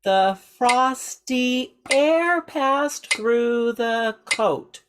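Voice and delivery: rhythmic spoken line, exaggerated inflection. Shown as a demonstration. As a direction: speak it to the metronome, letting pitch rise and fall. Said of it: "say it with me".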